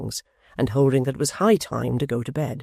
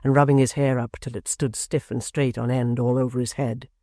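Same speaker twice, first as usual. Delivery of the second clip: ordinary delivery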